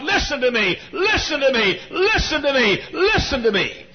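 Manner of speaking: high, impassioned pleading cry; begging God. Retold or imitated. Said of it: "I'd beg God".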